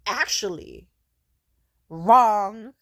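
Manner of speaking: sudden loud mock correction on wrong; mock all-caps shout. Mocking a commenter who typed in all caps, loud.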